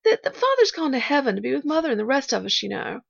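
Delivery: put-on earnest child with a touch of sadness; halting, then gently hopeful child; lightly voiced little girl. A narrator's reading. Pollyanna confiding in her supposed aunt, earnest and halting.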